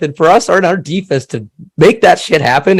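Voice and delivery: ordinary delivery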